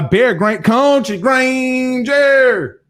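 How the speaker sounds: hammed-up slow name call; drawn-out intro. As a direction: stretch the name out like a ring announcer.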